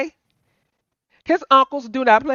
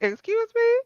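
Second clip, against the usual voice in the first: very high-pitched, affronted question; acted high "excuse me?"; indignant auntie; 13 semitones higher, 3 dB quieter than usual. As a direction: squeak it up in mock disbelief. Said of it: "And I'll be like".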